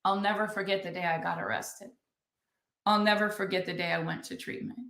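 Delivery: soft, low, tearful retelling of Renee's words; tearful quote. Retold or imitated. Retelling a friend's confession at the networking event, softly tearful. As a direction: let the tears sit under each line, hushed. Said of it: "And with tears in her eyes".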